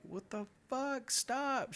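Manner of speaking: high, drawn-out mock cry; alarmed girlfriend. Girlfriend seeing his bleeding hand, imitated high alarm. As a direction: imitate her shrieked protest without full volume.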